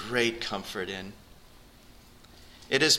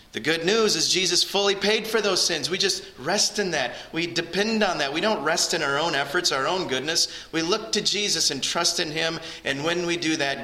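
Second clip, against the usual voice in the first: raised, ringing sermon voice, pressing the point; fervent preaching; 5 semitones higher than usual. Acted. Pastor calling listeners to Jesus, raised fervent preaching. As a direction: pastor urging the congregation to come to God, preaching fervently.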